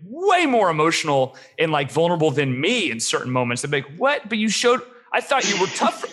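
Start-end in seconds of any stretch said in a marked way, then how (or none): 4.0-6.0 high-pitched mock bafflement; retold confusion in a high, incredulous voice; confused. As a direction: act out being thrown, voice jumping way up. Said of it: "I was so confused by your biotype".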